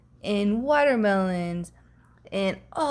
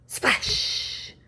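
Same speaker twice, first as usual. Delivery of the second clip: plain reading voice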